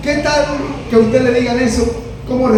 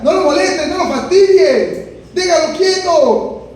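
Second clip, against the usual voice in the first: scolding disciples; 7 semitones higher than usual. Acted. Disciples hushing Bartimeo.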